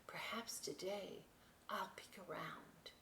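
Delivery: secret whisper. Acted. Little boat confiding a daring plan, whispered. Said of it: "Brightness said".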